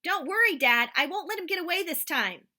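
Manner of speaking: high, loud determined shout; boy shouting promise to dad. Acted. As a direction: yell it with grit. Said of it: "I shouted".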